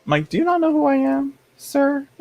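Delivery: high, indignant put-on voice; squeaky mock outrage at a viewer; mock haughty diva. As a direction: play the offended celebrity demanding respect.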